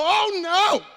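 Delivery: loud, high comic protest; hammed-up loud refusal; mock refusal shout. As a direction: shout it like you want no part. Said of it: "you go".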